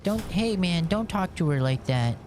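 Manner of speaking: 1 meme guy. Retold or imitated. Imitating meme guy defending her.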